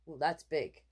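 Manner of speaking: plain reading voice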